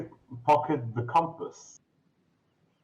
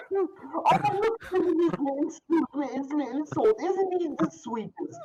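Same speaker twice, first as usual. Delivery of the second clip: put-on very high, quick baby talk; cooing lady. Shop lady gushing over the cat, very high and fast. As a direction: dote on the little cat.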